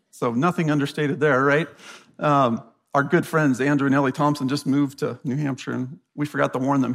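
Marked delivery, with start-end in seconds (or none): none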